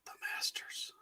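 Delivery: hushed golf announcer; golf commentator whisper. Pretend. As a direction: whisper it like at the Masters. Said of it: "I had to use my golf voice".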